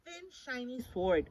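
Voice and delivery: ordinary delivery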